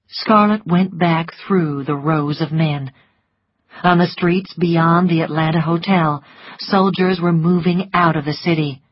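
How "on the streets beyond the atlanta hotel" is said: plain reading voice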